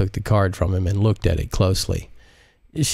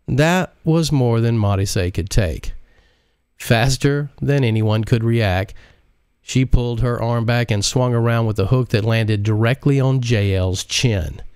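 usual speaking voice

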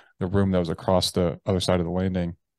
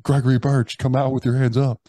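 loud, higher-pitched imitation of police; police bullhorn; 6 semitones higher, 6 dB louder than usual. Police to man in the house, imitated bullhorn call. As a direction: mimic an amplified order to surrender. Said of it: "on bullhorns".

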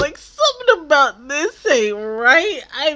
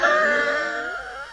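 high squealing laugh; 13 semitones higher, 4 dB quieter than usual. Real, not acted. Laughing at scene.

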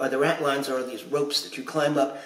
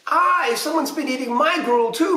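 high howl of pirate outrage; high-pitched storybook complaint; 10 semitones higher, 4 dB louder than usual. A narrator's reading. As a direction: howl it like an indignant pirate mama. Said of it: "Mama howled".

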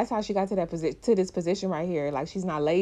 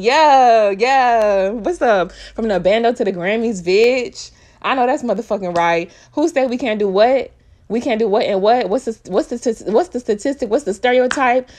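excited hype; 3 semitones higher, 9 dB louder than usual. Real, not acted. Celebrating [name]'s Grammy win.

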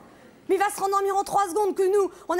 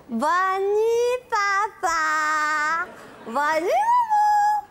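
put-on child voice; 2 semitones higher, much slower than usual. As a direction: sweetly chirp goodnight like a child. Child sweetly says goodnight. (voix de petite fille sage)

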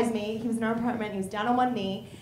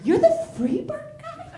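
incredulous outrage; 14 semitones higher, 6 dB louder than usual. Retold or imitated. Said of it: "I was like".

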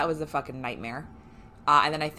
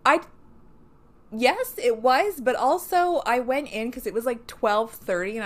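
excited; 7 semitones higher, 7 dB louder than usual. Real, not acted. Excited retelling, louder and higher.

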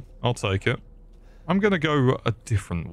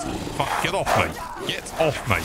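higher, strained protest; pitched-up flustered struggle; panicked protest; 4 semitones higher than usual. Real, not acted. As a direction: fend off an attacker grabbing you. Grabbed by enemy in game.

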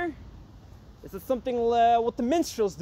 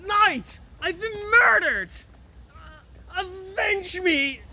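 dying cry; 6 semitones higher than usual. Acted. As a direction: call for help while dying. Lord cries out after being stabbed.

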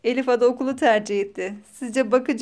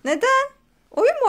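surprised; 12 semitones higher, 7 dB louder than usual. Real, not acted. Mother surprised at answer.

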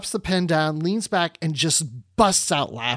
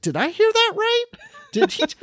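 very high, incredulous question breaking into laughter; incredulous amusement. Retold or imitated. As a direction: imitate her stunned disbelief, squeaking up high. Acting out her amused disbelief.

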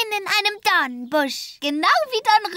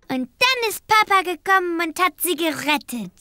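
ordinary delivery

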